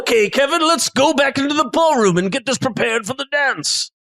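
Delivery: high-pitched chef giving orders; bossy chef. Acted. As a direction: send the helper off as the chef. Chef orders Kevin along.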